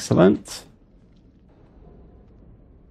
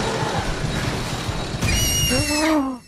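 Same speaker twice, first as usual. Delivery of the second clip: frightened gasp; 6 semitones higher, 7 dB quieter than usual. Real, not acted. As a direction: gasp in terror. Jump scare.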